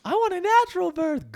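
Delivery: very high mocking sing-song; husband rewinding the tape to tease her, very high; tape replay mock. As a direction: play it back like a looped tape. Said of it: "keep rewinding it and keep playing it".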